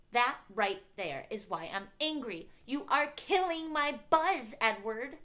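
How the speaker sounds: high, loud exasperated outburst; snapped. A narrator's reading. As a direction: snap angrily, hammering each word. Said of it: "I snapped".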